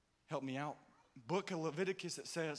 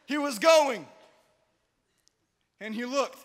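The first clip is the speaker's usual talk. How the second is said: loud, high preached line; earnest preacher cry; serious preaching shout; 7 semitones higher, 15 dB louder, slower than usual. Acted. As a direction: shout it like you mean it. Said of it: "Let's get serious".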